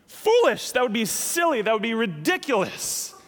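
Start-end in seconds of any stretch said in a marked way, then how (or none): 0.1-0.7 foolish jumped high and loud; stress on foolish. Real, not acted. Pastor stressing Jesus' wordplay, punched foolish. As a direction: hit foolish hard and high.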